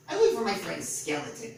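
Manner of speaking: a hint of a small eager goblin; lightly voiced goblin question. A narrator's reading. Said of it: "said Goblin".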